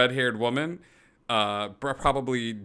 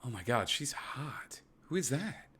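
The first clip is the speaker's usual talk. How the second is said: breathy awed whisper; hushed whisper; 6 semitones higher, 6 dB quieter than usual. Real, not acted. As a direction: whisper it, dazzled. Player reacts to attractive NPC.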